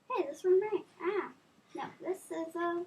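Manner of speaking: plain reading voice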